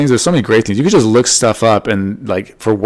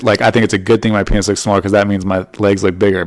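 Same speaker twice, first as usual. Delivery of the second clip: normal talking voice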